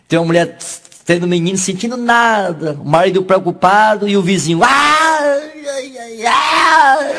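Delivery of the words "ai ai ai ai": high, crying ouch ouch ouch; the husband suddenly feels the birth pain; hammed-up wailing in pain; pained cries.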